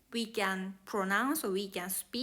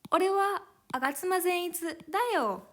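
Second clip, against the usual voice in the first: high, quick anime whine; higher, hurried character voice; Agatsuma Zenitsu; 7 semitones higher, faster than usual. Acted. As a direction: pitch it up like a crybaby character. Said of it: "He is always crying".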